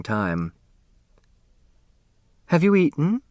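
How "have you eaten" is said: higher, sweet motherly question. Acted. Grace asking Turtle about dinner, higher sweet motherly voice. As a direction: ask with sudden kindness.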